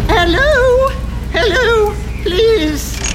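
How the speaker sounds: put-on high, loud, plaintive old-lady call; pretend little old lady. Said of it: "maybe pretend to be one".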